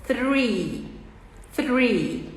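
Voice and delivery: "Three" com a língua entre os dentes. Shown as a demonstration. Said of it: "três em inglês se fala com a língua entre os dentes". ("Three" com th soprado entre os dentes)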